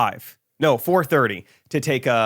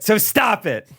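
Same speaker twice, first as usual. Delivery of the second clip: higher, scolding command; mock scolding; 8 semitones higher, slower than usual. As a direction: snap it at an offender. Mock list of forbidden things.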